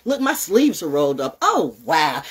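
normal talking voice

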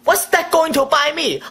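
usual speaking voice

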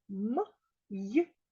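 М-й-а-ч each sound separate. Shown as a demonstration. Teacher sounding out a word for pupils. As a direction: spell Мияч sound by sound. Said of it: "Мияч".

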